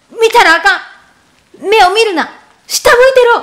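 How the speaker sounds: loud high yell, rising to a scream. Retold or imitated. Mother screaming at her son in the car, loud urgent yell. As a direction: bark urgent orders at full volume. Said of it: "て叫んだ".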